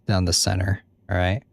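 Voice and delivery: ordinary talk, nothing marked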